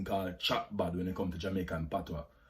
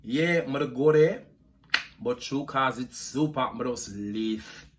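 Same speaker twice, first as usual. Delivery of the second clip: slow Jamaican patois drawl; slower than usual. Shown as a demonstration. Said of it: "Jamaican accent tag".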